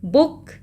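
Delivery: quick, short oo in बुक. Shown as a demonstration. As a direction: cut the vowel of बुक short. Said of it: "छोटा है".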